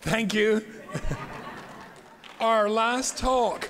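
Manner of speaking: big enthusiastic take, slow and high. Shown as a demonstration. Lecturer to audience, asking for a bigger welcome, loud and high. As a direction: make it sound like a grand occasion. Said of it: "More like it".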